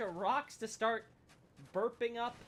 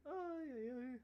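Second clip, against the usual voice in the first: mock crying; 6 semitones higher, 7 dB quieter, slower than usual. Mock lament after dying.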